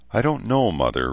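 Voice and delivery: ordinary delivery, nothing marked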